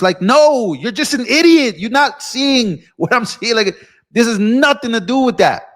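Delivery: heated, emphatic rebuttal; exasperated retort. Real, not acted. Angry retort to accusers.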